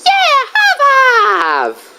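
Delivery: high, drawn-out cartoon cheer; stretched high-pitched whoop; Diddy Kong. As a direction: celebrate slowly in a very high voice. Diddy celebrates with host.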